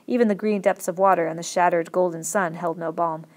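ordinary delivery, nothing marked

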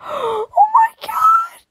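very high, slow, stretched gasp of shock; stunned high wail; drawn-out shriek. Real, not acted. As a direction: squeal it out long and high. Gasping at a drawing.

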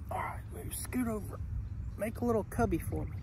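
soft whisper, nudging the cow aside. Real, not acted. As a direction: whisper it close, no voice. Snuggling against the cow.

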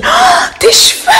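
high, slow, exclaiming mock cry; imitating Elsa. Daughter waking up, imitated high exclaiming about the hair. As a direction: shriek it like the startled girl. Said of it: "elle me dit".

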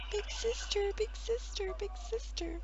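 high little-sibling voice calling sister; childlike voice. Retold or imitated. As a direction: call out like a small child. Imitating a childlike call.

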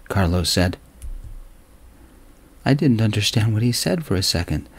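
ordinary delivery, nothing marked

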